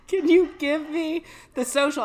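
high, loud retold question through laughter; amused retelling. Retelling a friend's question on the prank call, laughing. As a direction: quote the prank victim, breaking up laughing. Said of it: "the funniest part is he goes".

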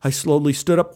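ordinary delivery, nothing marked

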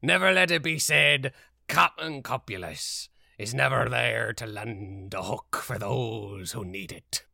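loud hearty pirate promise; pirate captain. Acted.

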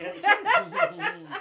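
high, loud laughing tease. Real, not acted. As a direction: crack up while teasing her. Host teasing guest about siblings.

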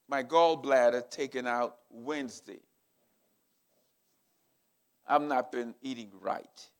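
normal talking voice

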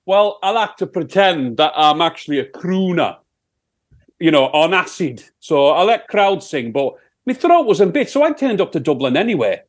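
put-on Sheffield accent. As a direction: answer as a broad Sheffield rock singer. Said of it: "it's my Sheffield accent".

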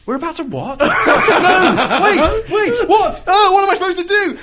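high, loud, laughing panic; panicked disbelief. Retold or imitated. Passenger hearing they're about to roll, high panicked laughter. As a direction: squeal in disbelief as the car leaves the runway. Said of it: "I remember thinking".

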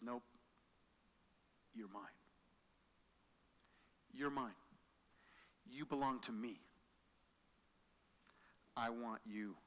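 soft, low reassuring voice; tender God. Acted. God's mercy claiming the sinner, tender and soft. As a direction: hold them close with the words.

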